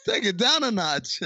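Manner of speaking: lightly acted, high-voiced plea to ease off; imitated interjection. Podcaster quotes an actor cutting in from the back, imitated interjection.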